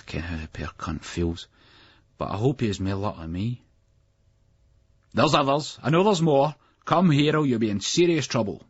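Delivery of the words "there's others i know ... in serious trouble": higher, commanding, threatening; stern teacher. A narrator's reading. Strict teacher threatening hidden late boys, stern. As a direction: warn them with authority. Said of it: "teacher threatens the boys".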